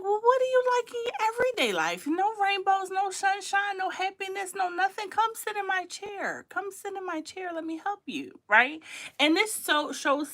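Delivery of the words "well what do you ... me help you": mock counselor. Host imitating a therapist coaxing a troll to sit and talk. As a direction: lift the voice like a gentle therapist inviting them in. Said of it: "I pray for these kinds 'cause I'm like".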